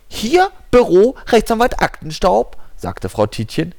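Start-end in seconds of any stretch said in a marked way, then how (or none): 0.1-2.6 secretary voice. Acted. Secretary answers phone.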